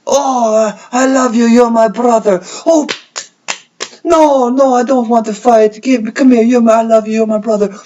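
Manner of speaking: high, loud put-on character voice; hammed-up character quote. Host acting a quote for chat to guess, high loud pleading. Acts out quote, asks its source.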